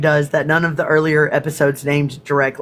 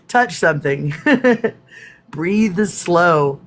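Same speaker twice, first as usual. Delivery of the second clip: ordinary talk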